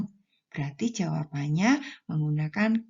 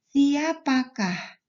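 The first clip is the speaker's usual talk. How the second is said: siapakah said slowly and higher, with weight on the final kah; stressing the particle kah; 5 semitones higher, 3 dB louder, much slower than usual. Shown as a demonstration. Teacher shows pupils the question particle kah. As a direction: stretch out siapakah and lean on kah. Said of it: "Bisa diakhiri partikel kah".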